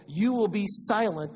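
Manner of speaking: weighty, deliberate command; Gabriel. Acted. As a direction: pronounce it as an angel's sentence. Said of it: "And the angel said".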